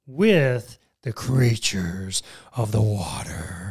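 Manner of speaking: low, slow spooky catchphrase; deep scary voice. Pretend. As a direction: drag it out ominously. Repeats spooky show catchphrase.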